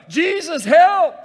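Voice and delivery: loud high desperate plea; mock cry for help. Disciple begging Jesus from the boat, mock desperate yell. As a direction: scream for rescue, hammed up.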